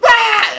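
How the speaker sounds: loud high shriek; short comic scream, full volume; repeated screaming. Retold or imitated. Boy replying to the homeowner, loud high scream. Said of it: "and just kept screaming".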